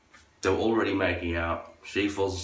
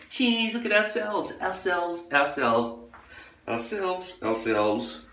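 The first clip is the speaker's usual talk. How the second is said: much higher, slow, hammed-up girly singsong; mock selfie girls; 11 semitones higher, much slower than usual. Girls pose for a selfie in a high, drawn-out mock voice. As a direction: drag out ourselves like a preening girl.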